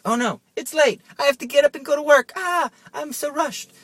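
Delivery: high, loud mock panic; frantic cry, way up high and hammed-up; panicked waker. Someone waking up late for work, hammed-up panic.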